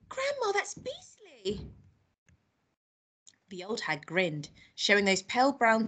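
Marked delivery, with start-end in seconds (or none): 0.1-1.7 put-on high, soft, slow child's horror; appalled boy. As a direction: recoil in a small high voice. Boy appalled by beetle eating.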